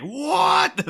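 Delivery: imagined listener's incredulous outburst; mock outrage. Podcaster imagining a listener hearing this, high mock-outraged cry. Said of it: "hearing this and been like".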